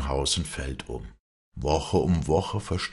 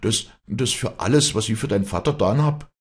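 raised, protesting voice; crying out; 7 semitones higher, 5 dB louder than usual. A narrator's reading. Vinzenz protesting after serving her father, indignant outcry. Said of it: "schrie Vinzenz auf".

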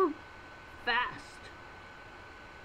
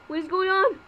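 normal talking voice

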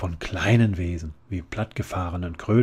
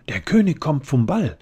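loud shout, pitched higher; 8 semitones higher, 8 dB louder than usual. A narrator's reading. Flora bursts out with the news, shouting. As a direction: blurt it out at full volume. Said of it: "schrie Flora Zottelsee".

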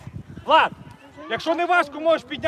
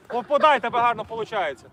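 ordinary talk

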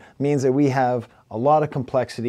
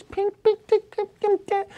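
much higher imitation of pinging beat notes; imitating high notes. Shown as a demonstration. Reviewer imitating the beat's high-pitched notes. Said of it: "high-pitched random notes".

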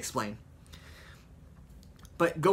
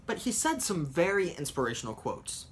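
normal talking voice

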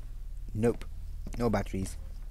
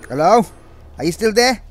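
raised, higher questioning call; 7 semitones higher, 8 dB louder than usual. Real, not acted. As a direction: call to someone out of sight. Calls out to girl in game.

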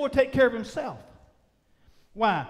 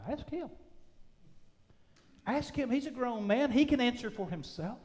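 softer, lightly voiced parents passing the buck; parents disowning son; 3 semitones higher, 5 dB quieter than usual. Parents deflecting questions about their son, lightly voiced. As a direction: lightly act parents shrugging off responsibility. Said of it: "looking at your mom and dad and them going".

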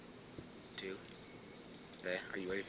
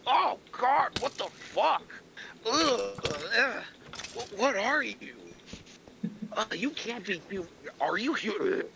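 mock English monster; 6 semitones higher than usual. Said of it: "he goes completely English and goes".

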